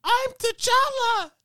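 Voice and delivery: put-on high, slow whiny kid voice; mock a little kid, drawn out. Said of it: "He's like".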